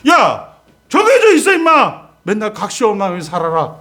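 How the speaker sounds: high, loud rebuke, half in jest; scolding God. Acted. Preacher voices a scolding God.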